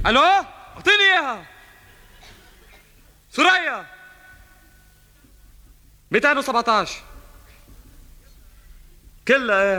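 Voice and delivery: loud high phone shouting. Acted. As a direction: yell down a bad line. (صراخ على التلفون)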